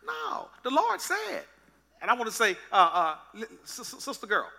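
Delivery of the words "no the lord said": lightly voiced Eve, high and slow; a hint of an unsure Eve; Eve's reply. Eve answering the serpent in a high slow voice. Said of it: "She said".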